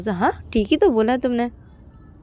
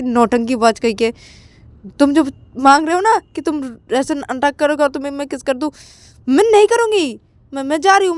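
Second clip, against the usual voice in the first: playful refusal; 6 semitones higher than usual. Acted. Payal slapping Ananya lovingly, refusing. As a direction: refuse the kiss teasingly.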